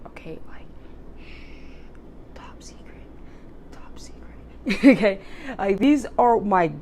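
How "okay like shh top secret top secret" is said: conspiratorial whisper, shh. Real, not acted. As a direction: whisper the secret, no voice. Sharing a secret food hack.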